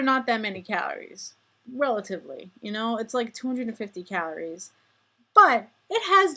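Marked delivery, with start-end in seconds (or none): 5.3-6.4 emphatic outburst. Real, not acted. Building to calorie punchline.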